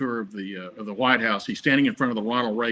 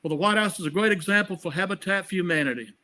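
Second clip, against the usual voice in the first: higher Southern Carter voice; Jimmy Carter impression; 7 semitones higher, faster than usual. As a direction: do your Carter for Eddie. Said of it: "Let me hear your Carter".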